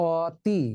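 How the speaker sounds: "অতি" with an open aw first; as spelt. Shown as a demonstration. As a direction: say "অতি" letter by letter, first vowel aw. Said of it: "শব্দটি হওয়ার কথা". ("অতি" বানান অনুযায়ী, খোলা অ)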